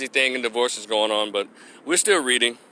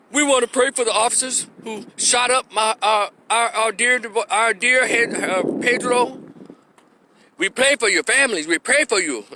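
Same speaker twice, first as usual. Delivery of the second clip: higher-pitched, halting mock prayer appeal; mock pious mourner; 6 semitones higher than usual. Imagined relative praying for the officers, mocking piety. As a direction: imitate a forgiving relative, pitch raised.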